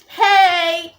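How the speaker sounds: high, drawn-out hey; excited greeting. Retold or imitated. Girl greeting her crush, excited. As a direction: greet them brightly, pitched right up. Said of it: "so excited".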